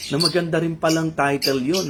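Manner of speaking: ordinary delivery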